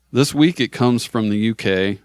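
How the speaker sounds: ordinary talk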